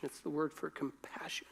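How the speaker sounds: soft whispered aside. Real, not acted. Preacher whispering the meaning of compassion to listeners. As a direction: breathe it out under the voice.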